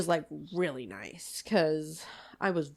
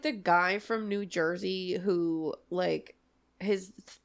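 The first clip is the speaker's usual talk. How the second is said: normal talking voice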